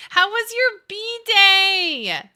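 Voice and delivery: high, loud sing-out, stretched long; gushing greeting. Real, not acted. Streamer asks a regular about their birthday, gushing. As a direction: squeal the question out slowly, thrilled.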